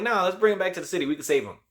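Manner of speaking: high and quick, pushing a bright idea; eager Deborah. Retold or imitated. Deborah urging them to bring Morbius back, high and quick. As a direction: rattle it off fast like it's genius.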